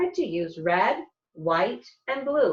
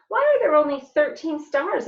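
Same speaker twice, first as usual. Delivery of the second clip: put-on higher, louder curious kid voice; child's voice; 8 semitones higher, 5 dB louder than usual. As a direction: ask it like an eager child. Child asks teacher a question.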